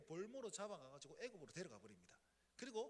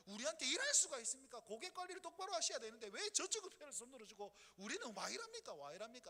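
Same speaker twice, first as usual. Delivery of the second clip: louder, higher, indignant questioning of God; Habakkuk demanding answers from God, indignant and loud; indignant complaint; 4 semitones higher, 4 dB louder than usual. Acted. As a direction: protest to God like a sulky prophet. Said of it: "하박국이 조금 삐딱했어요".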